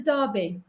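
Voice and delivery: Derby said as Darby, the British way. Real, not acted. Host asking a player about the event, Derby said British. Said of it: "sorry, my accent says Derby".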